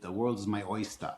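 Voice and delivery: non-rhotic Boston "oystah"; Boston accent, ending oyster on "-ah". Real, not acted. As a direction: drop the R in oyster, Boston style. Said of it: "There's that Boston accent".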